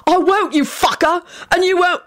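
high, defiant yell; high-pitched angry refusal. A narrator's reading. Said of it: "he yelled".

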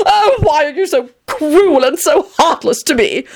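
high, theatrical pleading wail; piteous cry. A narrator's reading. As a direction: cry it out like a wounded drama queen. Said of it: "Tony cried out piteously".

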